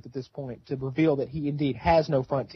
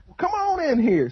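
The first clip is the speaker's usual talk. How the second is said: hillbilly uncle; 12 semitones higher than usual. Retold or imitated. Voicing Uncle Terry.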